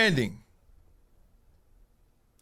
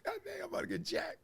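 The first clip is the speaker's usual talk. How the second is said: high, softer panicked thought; 10 dB quieter than usual. Retold or imitated. Comic as his teen self sure he'll be robbed, high panicked voice. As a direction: squeak it out in dread. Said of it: "I'm [name] before [name]".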